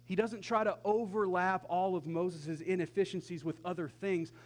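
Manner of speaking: ordinary talk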